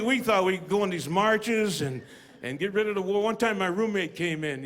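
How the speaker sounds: ordinary delivery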